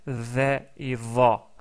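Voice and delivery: "ve et va" very slowly, each vowel held; contrasting sounds. Shown as a demonstration. Teacher slowly contrasting "ve" and "va" for the class. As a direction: stretch out "ve" then "va". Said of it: "Donc il y a fait".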